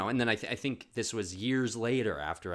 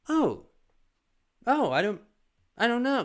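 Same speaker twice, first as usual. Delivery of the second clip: high, louder panicked stammer; flustered panic; 13 semitones higher, 8 dB louder than usual. Retold or imitated. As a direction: squeak it out, caught out. Mom caught flustered, voiced.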